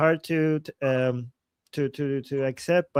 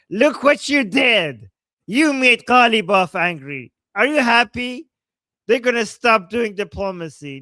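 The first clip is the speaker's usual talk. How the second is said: louder, higher hammed-up scolding; mock scolding; 5 semitones higher, 7 dB louder than usual. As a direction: scold the chat in jest.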